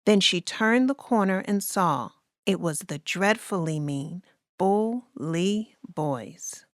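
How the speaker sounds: ordinary delivery, nothing marked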